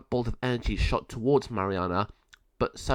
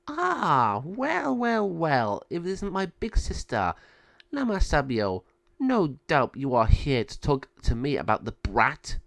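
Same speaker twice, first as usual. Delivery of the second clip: high, sneering villain voice, teasing her big sister; sly villain sister; 4 semitones higher than usual. Acted. Villain Malvador greets her sister.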